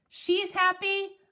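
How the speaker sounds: ordinary delivery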